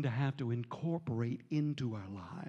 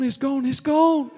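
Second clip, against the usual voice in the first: each "gone" higher and much louder, near a shout; excited shout; 11 semitones higher, 12 dB louder than usual. Retold or imitated. Healed man telling the preacher, shouting with joy.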